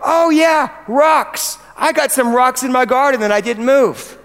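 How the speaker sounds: a listener hijacking the chat with a shout; excited shout. Pretend. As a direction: blurt it out, jumping in. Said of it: "somebody goes".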